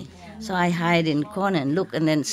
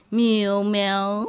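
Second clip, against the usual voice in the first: imitated meows. Shown as a demonstration. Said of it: "I love you, mean".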